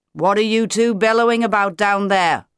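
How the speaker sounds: ordinary delivery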